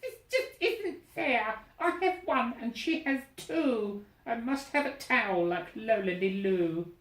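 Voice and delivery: put-on stamp your foot like a sulky young bird; jealous little bird. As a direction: Gertrude envying Lolly Loo, high and whiny. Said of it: "she shouted".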